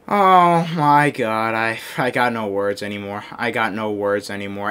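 low, soft, worn-out muttering; defeated resignation. Real, not acted. Gamer speechless after another bad play, quietly defeated. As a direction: give up, drained of all fight.

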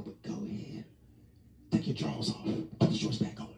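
whispered sly instructions; a conspiratorial whisper to the wife. Retold or imitated. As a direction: hush it like a secret plan.

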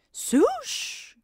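high whispered swoosh rising. Acted. Narrator imitating the coaster again, whispered high swoosh. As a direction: breathe the swoosh up high and fast.

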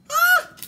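sudden shrill puppet cry; puppet squeal. Acted. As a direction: squeal with delight like a toy. Puppet reacts excitedly. (grito agudo de títere)

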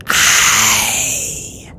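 long, rough, breathy dragon voice; creaking creature voice; creaky, eerie rasp. A narrator's reading. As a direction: drag the word out in a rasp. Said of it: "creaky and quiet and rough and eerie".

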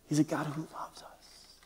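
soft, tender whispered assurance. Real, not acted. Preacher reassuring his congregation, whispered. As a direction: breathe it gently to the crowd.

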